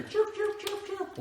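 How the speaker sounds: very high chirping bird voice; parakeet chatter. Pretend. Hoping no baby mice in here.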